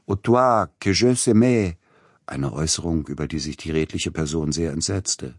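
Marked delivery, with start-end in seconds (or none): 0.0-1.8 loud, high-pitched French outburst; delirious shout. A narrator's reading. Delirious patient to the nurse with the enema, shouting. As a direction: shout at her in a raving fit. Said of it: "schrie er ihr zu".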